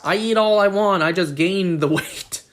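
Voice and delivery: ordinary talk, nothing marked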